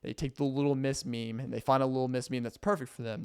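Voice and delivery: ordinary talk, nothing marked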